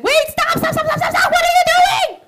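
high, loud desperate stop; Mary shouting at villagers pouring oil; frantic shouting. Acted. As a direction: scream at them to stop. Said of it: "Mary saw this and said".

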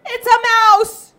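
high, loud shriek about a mouse; mock fright for the children; alarmed cry. Storyteller shows alarm at mouse.

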